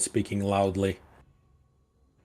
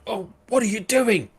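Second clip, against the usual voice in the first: much higher, squeaky guard voice; guard's high squeak; 13 semitones higher than usual. Acted. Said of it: "like the guard".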